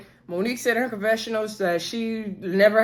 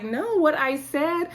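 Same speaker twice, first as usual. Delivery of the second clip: higher, drawn-out imitation of Winter insisting; slow mock correction; 6 semitones higher, slower than usual. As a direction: stretch it out, putting her right.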